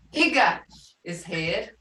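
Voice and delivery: ordinary talk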